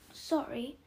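usual speaking voice

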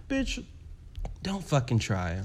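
softly voiced mock warning; dismissive imitation. Sizing up a rival queen Blanca, hushed and dismissive. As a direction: say it under your breath, dismissively. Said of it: "looking at Blanca like".

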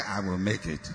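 mocking a half-hearted congregation, low and quiet; limp mumble. Preacher mocks the church's weak reply, limp mumble. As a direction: mumble it like you don't believe it. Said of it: "did I say".

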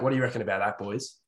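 usual speaking voice

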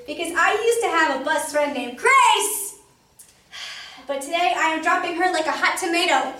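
put-on girl loudly tells a boy he can sit, for Grace to hear; real loud. A narrator's reading. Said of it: "I raised my voice real loud".

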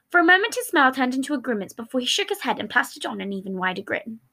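usual speaking voice

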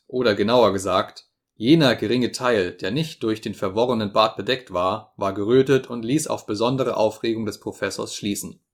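usual speaking voice

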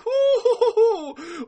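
shrieking burst of laughter, high and loud; high squealing laugh. Real, not acted. Laughing mid-skit.

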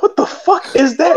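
high-pitched shocked cry; imitating his own panic at the pain; shocked high yelp. Injured guy yelling at the sudden pain. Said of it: "I was like".

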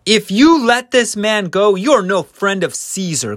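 high, loud accusing shout; crowd crying out. Acted. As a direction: shout the warning as an angry mob.

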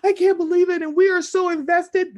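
imitation of breathless investment; mock amazement. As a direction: mimic fans who can't believe it. Mimicking viewers' gushing disbelief.

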